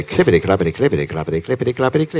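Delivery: rapid-fire imitation of horses' hooves; galloping hooves. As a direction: patter it out like a hurried gallop. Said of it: "What did the horses sound like".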